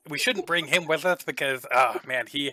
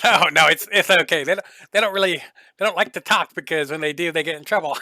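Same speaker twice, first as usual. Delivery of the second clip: loud merchant; 3 semitones higher, 7 dB louder, faster than usual. Acted. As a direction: laugh it off loudly to hide something. NPC excuses his helpers.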